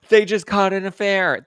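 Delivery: high, loud mock shock; scandalized glee. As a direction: play an onlooker thrilled by scandal. Acts out the shocked, amused onlooker.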